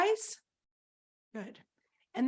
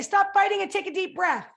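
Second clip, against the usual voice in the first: put-on high, loud order to squabbling kids; scolding grandmother; 8 semitones higher, 6 dB louder than usual. Grandmother to fighting children, high loud scolding. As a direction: call out like grandma stopping a fight. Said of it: "Grandma would say".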